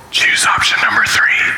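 hushed, voiceless stage whisper; whispered divine voice. Acted. God answering a prayer with an unexpected option, whispered.

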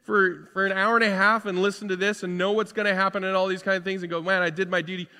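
high and loud, fired-up preacher; rousing pulpit voice lifting the whole room; full-force preaching. Acted. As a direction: preach it out to the back row.

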